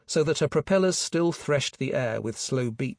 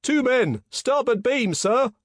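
high, loud report to the officer; lookout's yell; 12 semitones higher, 6 dB louder, slower than usual. A narrator's reading. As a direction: yell a sighting from the lookout post. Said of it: "yelled the starboard lookout".

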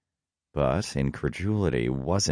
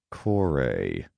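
restrained, through gritted teeth. A narrator's reading. Hades objects to Kore leaving, gritted out. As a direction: grit the name out. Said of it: "I gritted out".

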